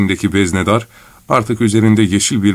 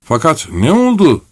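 higher-pitched, mild mock shout; acted cry, little extra volume; shouted question; 7 semitones higher than usual. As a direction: call out like a puzzled crowd. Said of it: "diye bağırdı herkes".

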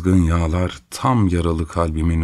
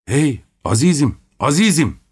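raised calling shout; 7 semitones higher than usual. A narrator's reading. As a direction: call after him hurriedly. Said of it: "diye bağırdım". (acele acele bağırarak seslenme)

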